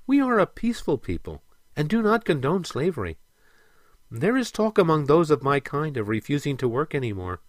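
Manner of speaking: peaceful mermaid, lifted pitch; earnest mermaid. Acted. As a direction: plead your people's case earnestly.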